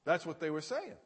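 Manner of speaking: normal talking voice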